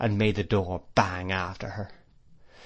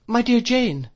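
high, loud startled cry; 13 semitones higher, 6 dB louder than usual. A narrator's reading. Mother reacts to Miss Murdstone's scream, crying out. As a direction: cry out in alarm, pitch leaping up. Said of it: "cried my mother".

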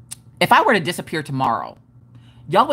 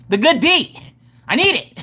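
higher, insistent outburst; mock desperate plea; 6 semitones higher than usual. Asking crafters for the good D, mock desperate. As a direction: demand it in playful desperation.

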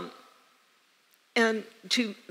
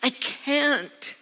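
to Jesus, protesting she is only human; pleading protest; 5 semitones higher, slower than usual. Retold or imitated. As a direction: push back hard in a raised voice. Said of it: "And I said".

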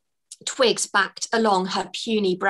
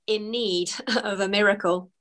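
ordinary delivery

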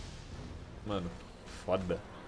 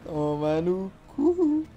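laughing; 11 semitones higher, much slower than usual. Real, not acted. Gamer laughs after monster falls.

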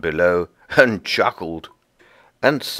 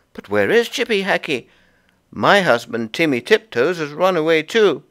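higher, worried wife; higher worried squirrel wife; Goody Tiptoes; 10 semitones higher, 3 dB louder than usual. Acted. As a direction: ask after her husband anxiously.

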